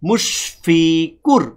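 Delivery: মুশফিকুর said very slowly, syllable by syllable; slow teaching demo. Shown as a demonstration. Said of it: "বললাম কুর".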